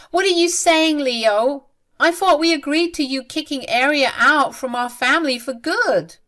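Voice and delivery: high, loud exasperated question; frustrated outburst. A narrator's reading. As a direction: push back at him in frustration. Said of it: "Lena got super frustrated and said".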